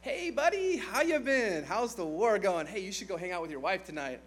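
fake friendly king. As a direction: sweet-talk Uriah like an old pal. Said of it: "He brings Uriah home and says".

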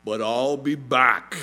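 lightly voiced Terminator, slow and loud; a hint of movie-hero delivery, drawn out; Terminator catchphrase. Dad tells family he'll return, light Terminator imitation. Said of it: "Not the Terminator, the Fathernator".

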